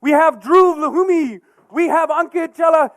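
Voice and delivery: loud, high show announcer. Acted. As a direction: hype up the introductions. Introduces improvisers to crowd.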